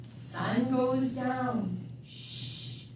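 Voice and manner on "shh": very soft shush. Acted. Reading the bedtime page, shushing softly. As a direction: hush it like bedtime. Night falls in the book.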